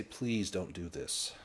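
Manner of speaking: quiet, slow pleading; soft plea. Acted. Cat begging the player not to bind it, soft plea. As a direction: plead softly, as the little cat. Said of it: "you hear that soft".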